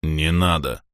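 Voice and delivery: low hoarse foreign-sounding voice; hoarse strange voice. Acted. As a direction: say it hoarse, like someone else. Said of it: "хриплый, как будто бы чужой голос". (хриплый чужой голос)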